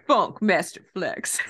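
low, slow hype-man name drop; radio DJ shoutout. Pretend. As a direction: announce it like a hip hop DJ.

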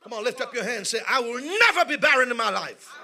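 loud shouted preaching, building to a climax; preaching at full force. Acted. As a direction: pound out every line to the congregation.

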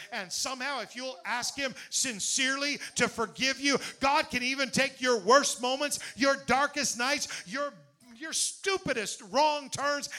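preaching at full force. Acted. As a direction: drive each phrase home, slowing on the last.

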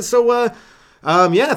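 ordinary talk, nothing marked

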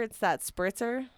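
ordinary talk

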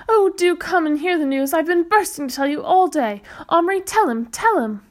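excited, high and loud; bursting with excitement. Acted. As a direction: burst out with the news. Mother bursting with news.

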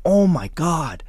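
high, gushing mock-British voice; put-on British accent, impressed. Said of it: "give him my British accent".

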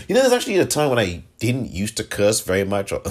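ordinary delivery

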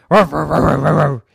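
loud imitation of a dog's ruff ruff; mock barking. Dog mocks how Salim hears him, loud mock barks. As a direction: bark it out like a dog. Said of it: "you only say".

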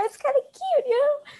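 high-pitched excited. Real, not acted. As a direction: say it way up high, playfully loud. Self-mocking squeal about own reaction.

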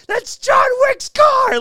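hammed-up shouting of the father's outrage; furious shout. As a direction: bellow it like an outraged mob boss. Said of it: "He's like".

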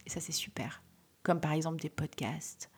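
soft whispered aside; whispering. Real, not acted. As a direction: whisper it conspiratorially. Whispered aside about free podcasts.

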